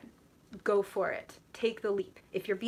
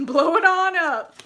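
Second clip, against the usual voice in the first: gleeful shout; 9 semitones higher, 14 dB louder than usual. Real, not acted. Laughing hard at chat comments.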